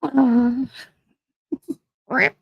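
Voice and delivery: helpless high-pitched laughter breaking through the words; can't stop laughing, barely gets words out; crying laughing. Real, not acted. Streamer to chat, cracking up at a joke, crying with laughter. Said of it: "I'm crying".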